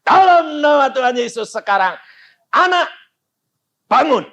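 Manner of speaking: loud, high-pitched call to rise; commanding prayer. Retold or imitated. Pastor raising the dead child, loud commanding prayer. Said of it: "Dia berkata".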